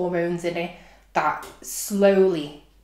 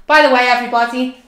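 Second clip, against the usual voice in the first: mock announcement; 5 semitones higher, 9 dB louder than usual.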